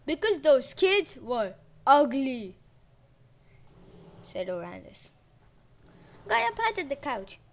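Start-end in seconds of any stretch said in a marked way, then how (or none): 0.0-2.6 slow gruff grunt. A narrator's reading. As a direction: grunt it out dismissively. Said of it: "he grunted".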